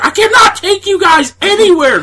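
squeaky high exasperated voice; high exasperated wife. Retold or imitated. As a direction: go high and fed up. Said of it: "Vicky's like".

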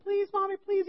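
put-on high, thin child voice; imitated pleading kids. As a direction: beg Mommy like a little kid. Kids pleading with their mother.

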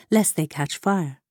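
ordinary delivery, nothing marked

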